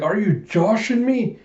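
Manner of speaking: high-pitched incredulous question; mock disbelief. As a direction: ask it in exaggerated disbelief. Character reacts in disbelief.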